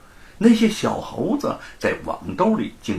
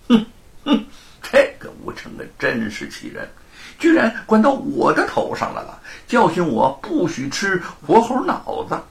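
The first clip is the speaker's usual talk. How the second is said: huffing indignation; 9 semitones higher than usual. A narrator's reading. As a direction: snort then complain bitterly. 罗庞 fuming about Wu. (气哼哼地抱怨)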